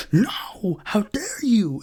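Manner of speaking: breathless, whispered, high mock gasp of outrage. Mocking critics outraged at Twitter labels, a hammed-up breathless gasp. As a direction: play a scandalised fainting critic.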